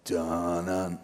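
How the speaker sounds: low, soft dun-dun like the Jaws music; Jaws theme hum. Shown as a demonstration. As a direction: hum the shark theme deep and quiet. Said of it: "if I say".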